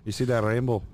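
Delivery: hammed-up Irish brogue; Irish accent. Shown as a demonstration. Threatening a punch at the rainbow's end, mock Irish accent. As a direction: play a scrappy comic Irishman. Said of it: "Do Irish".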